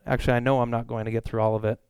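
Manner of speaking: ordinary talk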